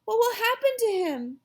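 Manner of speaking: put-on high, upset little-girl apology; girl crying out. A narrator's reading. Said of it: "Sheila cried".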